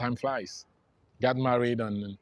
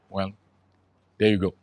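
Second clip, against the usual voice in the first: ordinary talk, nothing marked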